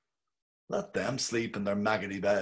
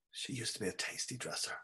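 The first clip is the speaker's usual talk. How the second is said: hushed thought; 13 dB quieter, faster than usual. A narrator's reading. Bloom's inner thought, hushed.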